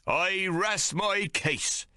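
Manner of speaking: high, very slow, smug captain voice; satisfied captain. A narrator's reading. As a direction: savour the win, slowly. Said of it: "most satisfied".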